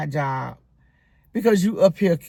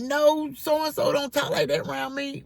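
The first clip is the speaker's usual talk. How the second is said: mock mama; 8 semitones higher than usual. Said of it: "they'll be like".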